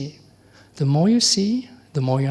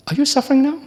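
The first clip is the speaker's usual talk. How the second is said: higher, louder direct question; pointed question; 10 semitones higher, 6 dB louder, faster than usual. Shown as a demonstration. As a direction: put it straight to the listener. Teacher asks the audience directly.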